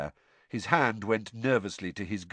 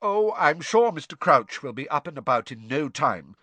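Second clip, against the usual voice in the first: puffed-up, raised voice; self-important Percy; 5 semitones higher, 4 dB louder than usual. Acted. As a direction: sound important. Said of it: "said Percy importantly".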